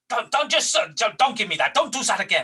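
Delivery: furious boss on the phone; angry caller. Retold or imitated. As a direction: tear a strip off the junior. Caller berates him.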